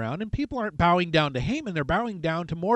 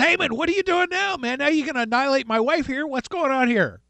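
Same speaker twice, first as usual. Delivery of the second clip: much higher and louder, fast angry outburst; furious king; 8 semitones higher, 6 dB louder than usual. Retold or imitated. King catches Haman at his wife, mock furious shout. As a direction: blow up at him like an outraged king. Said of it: "So he's ticked off".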